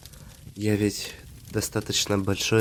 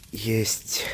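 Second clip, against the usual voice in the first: whisper. Acted. Whispered word in tender confession.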